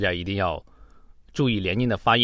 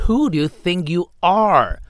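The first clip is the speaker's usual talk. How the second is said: high, scornful demand, WHO hit hard; disgusted anger; 7 semitones higher than usual. Shown as a demonstration. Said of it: "注意这种语气哈，有一种厌恶、愤怒". (厌恶又愤怒的语气)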